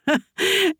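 high, short giggle; high-pitched amused laugh; audible laugh. A narrator's reading. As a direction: burst out in a girlish giggle. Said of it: "Alice lachte hörbar".

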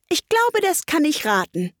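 high-pitched, eager declaration; confident, high little announcement; speaking up. A narrator's reading. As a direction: pipe up so all can hear. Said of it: "fuhr sie laut fort".

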